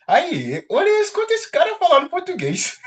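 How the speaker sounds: high, amused mock of a bystander; a light mock of a teasing listener; mocking onlooker. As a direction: imitate someone laughing at a funny accent. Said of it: "todo mundo fala".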